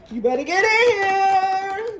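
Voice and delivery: high, loud and slow, hammed-up beckoning; playful loud call. Tarot reader calling viewers into the portal, loud and hammed-up. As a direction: call them in with big teasing energy.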